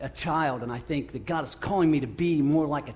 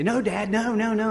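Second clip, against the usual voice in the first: put-on higher childlike protest, no, no; father imitating daughter refusing the old toys, higher; imitating little girl; 6 semitones higher than usual. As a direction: voice a little daughter objecting, pitched up. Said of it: "And her say".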